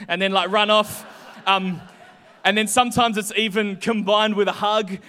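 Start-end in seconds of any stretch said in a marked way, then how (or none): none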